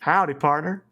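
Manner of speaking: low Southern cowboy greeting; Southern cowboy accent. Pretend. Streamer giving co-host a best howdy on request, Southern cowboy accent. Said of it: "give me your best howdy partner".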